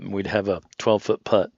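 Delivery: ordinary delivery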